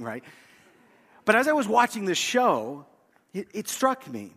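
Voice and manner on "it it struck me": usual speaking voice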